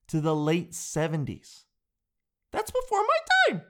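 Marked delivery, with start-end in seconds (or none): 2.5-3.6 very high, loud falsetto squeak; comic falsetto protest; squeaky mock whine. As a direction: squeal it like a scandalised kid.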